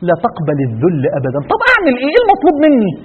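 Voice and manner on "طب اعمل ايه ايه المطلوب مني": much higher, pleading questioner's voice; high helpless "what do I do?"; puzzled listener. Pretend. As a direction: voice the confused believer asking what to do. Imagined listener asks helplessly.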